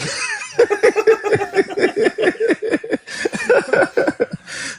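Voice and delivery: high-pitched, prolonged laughing fit; real high laughter that keeps going; long laugh. Real, not acted. Laughing at ball rep joke.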